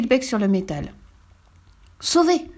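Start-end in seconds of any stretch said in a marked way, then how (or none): 2.0-2.5 high, bright cry of relief; relieved exclamation. A narrator's reading. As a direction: exclaim it, saved at last. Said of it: "s'exclama Verdurette".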